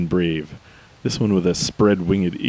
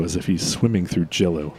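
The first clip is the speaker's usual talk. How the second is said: ordinary delivery, nothing marked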